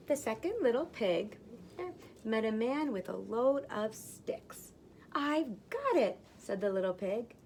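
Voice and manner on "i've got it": eager pig. Acted. Second pig deciding on sticks, eager and slow. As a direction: savour the bright idea.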